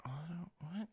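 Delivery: whispered curse under the breath; frustrated whisper. Real, not acted. Streamer whispering to himself when game audio fails. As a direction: hiss it to yourself, annoyed. Streamer confused over settings.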